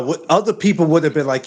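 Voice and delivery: ordinary talk, nothing marked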